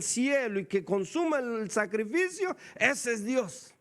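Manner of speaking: loud, high, fervent preaching; preaching at full force. Acted. Preacher booming the Elijah story to the congregation.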